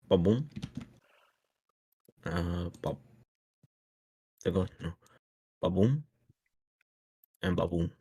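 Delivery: usual speaking voice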